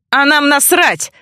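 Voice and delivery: angry bark, short of a full shout; barked retort. A narrator's reading. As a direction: snap it back at the accuser. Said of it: "рявкнул в ответ Ржавчина".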